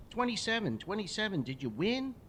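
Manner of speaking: put-on high, eager child's call across the yard; excited little boy calling. Little neighbour boy calling to his hockey hero, high and eager. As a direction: call out like a keen young kid.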